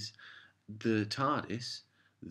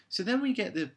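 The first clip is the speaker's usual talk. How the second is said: ordinary delivery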